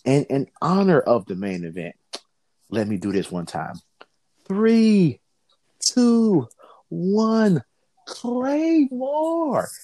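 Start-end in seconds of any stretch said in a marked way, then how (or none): 4.5-7.6 announcer countdown. Acted. Counts down for main event. 8.1-9.7 announcer call. Acted. Calls wrestler's finishing move.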